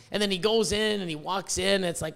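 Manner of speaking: ordinary talk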